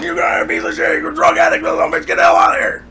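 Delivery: high, loud screaming rant; dad yelling at his son from the back. Retold or imitated. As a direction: scream it as the furious father. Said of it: "Screaming, top of his lungs".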